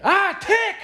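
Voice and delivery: high, loud startled yelp; mock panicked shriek; imitated scream. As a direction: scream it out like your friend spotting something horrible. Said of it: "he just screamed".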